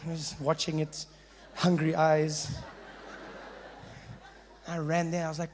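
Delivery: ordinary talk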